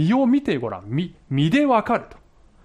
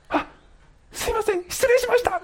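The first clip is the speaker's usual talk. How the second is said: mock woman, much higher, hurried sorry; flustered apology. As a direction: squeak out an embarrassed apology. Caller apologises, embarrassed. (間違いに気づいた女性の甲高い慌てた謝罪)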